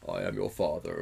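low, deep film villain impression; measured deep-voiced villain mimicry; James Earl Jones. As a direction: drop the pitch for a grave, iconic line. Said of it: "we all know the".

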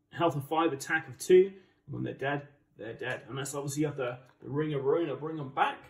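ordinary delivery

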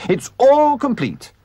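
high, loud, drawn-out crow; triumphant. A narrator's reading. Toad showing the finished caravan, triumphant. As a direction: stretch it out in triumph. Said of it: "said the Toad triumphantly".

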